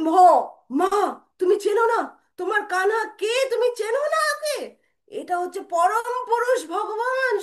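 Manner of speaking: high, loud, outraged declaration; impassioned proclamation. Acted. Brother telling mother she tied up God, high impassioned outcry. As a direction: proclaim his divinity with stretched, soaring words.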